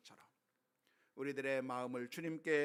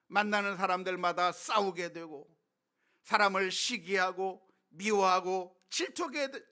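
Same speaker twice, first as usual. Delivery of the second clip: loud, raised sermon delivery; forceful preaching; 9 semitones higher, 13 dB louder than usual. Real, not acted. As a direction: drive each warning home from the pulpit.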